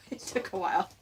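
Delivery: chuckling through the words; amused, half-laughing aside. Real, not acted. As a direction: laugh it out sheepishly. Laughs at himself mid-sentence.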